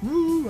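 soft high "woo"; distant crowd whoop, mimicked; crowd cheer. Crowd as the host leaves, small mock cheer. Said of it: "everyone goes".

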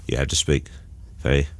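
Michael Caine speaking very quietly. Shown as a demonstration. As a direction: drop to a low murmur like Caine. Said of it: "speak very, very quietly like that".

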